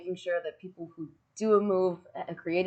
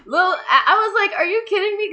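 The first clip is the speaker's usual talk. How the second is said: high, loud, laughing through the words; laughing disbelief; 13 semitones higher, 8 dB louder than usual. Real, not acted. Recalling the ladder match slap to his daughter, laughing high and loud. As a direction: crack up while reliving it.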